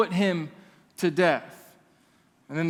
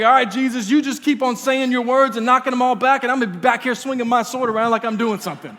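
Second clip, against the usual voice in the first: confident sidekick; 5 semitones higher, 5 dB louder, faster than usual. Pretend. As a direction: pretend bravado, higher and louder. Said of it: "I'd be back there like".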